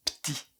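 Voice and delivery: Petit said as one syllable, p'tit; clipped p'tit. Shown as a demonstration. Teacher isolating the word, softly clipped. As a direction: drop the e of "Petit". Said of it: "Si vous parlez plus rapidement".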